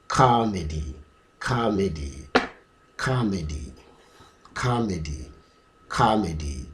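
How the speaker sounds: "Comedy" as KAH-medy, falling after the first syllable; variant with "kah". Shown as a demonstration. Teacher drilling the variant, open "kah" and falling tune. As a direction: repeat "Comedy" with open stressed "kah", dropping away. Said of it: "The R is stressed".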